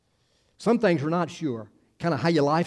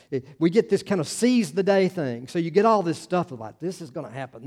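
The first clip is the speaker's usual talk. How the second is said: ordinary talk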